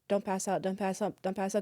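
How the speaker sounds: soft, repetitive chant to oneself; muttered self-talk. Retold or imitated. Pregnant graduate crossing the stage, coaching herself quietly. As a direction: say it under your breath, keeping yourself together.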